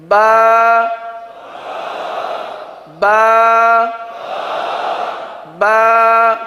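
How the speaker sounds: "با" sustained, loud and ringing; chanted drill of the letter "با"; Quranic recitation model. Shown as a demonstration. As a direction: hold "با" out like a recitation. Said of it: "پہلے آپ ادائیگی سن لیجیے".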